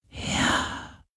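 unvoiced breathy ghost whisper; gehaucht. A narrator's reading. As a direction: breathe it out softly. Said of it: "hauchte der Geist des Meeres". (gehauchtes Flüstern)

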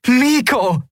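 high, joyful cry of a name; jubilant. A narrator's reading. Endriel cheering into the cube's recorder, high jubilant call. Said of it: "jubelte Endriel".

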